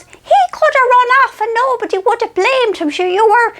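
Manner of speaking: mother soothing Marion, high and knowing; wise old mother. Retold or imitated. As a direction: deliver it like a sage old mother soothing her daughter. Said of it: "she goes".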